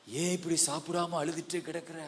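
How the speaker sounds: soft, subdued questioning; husband's gentle question. Acted. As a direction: ask it softly, as a concerned husband. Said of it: "புருஷன் வந்து கேக்குறான்". (மென்மையாகக் கேட்கும் கணவன்)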